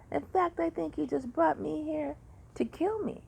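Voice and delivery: high, exasperated mock accusation; upset accusation. Upset patient accusing the surgeon in front of his assistant. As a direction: throw the charge out half joking. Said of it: "then I was really upset".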